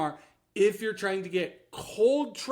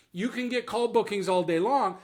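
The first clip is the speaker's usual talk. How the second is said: normal talking voice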